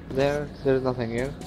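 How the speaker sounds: ordinary delivery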